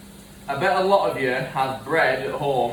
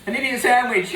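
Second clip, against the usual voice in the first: put-on high, loud London accent; 9 semitones higher, 4 dB louder than usual. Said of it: "in, like, a London accent".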